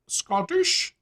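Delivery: high-pitched mock Scottish accent. Shown as a demonstration. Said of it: "What accent?".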